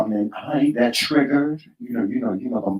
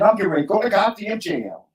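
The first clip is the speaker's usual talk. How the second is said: high-pitched heated comeback, retold; worked-up mock defiance; defiant retort; 6 semitones higher than usual. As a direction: replay an indignant answer to mom. Retold defiant answer to mother.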